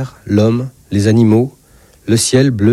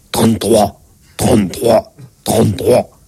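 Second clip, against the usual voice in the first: obedient patient; 3 semitones lower, slower than usual. Acted. God repeats it for the examination.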